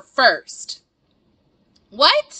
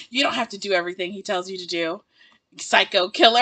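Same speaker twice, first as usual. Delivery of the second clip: quick mocking jibe; 6 semitones lower, 4 dB quieter than usual. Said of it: "she's like".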